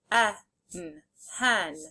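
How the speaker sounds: "H, en" as separate sounds, then "hen" whole; sounded out, blended. Shown as a demonstration. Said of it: "say the sounds and then blend".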